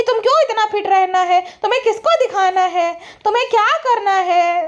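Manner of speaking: mock her needling with a laugh; mock nagging. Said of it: "तुड़वाने पे तुली है कि".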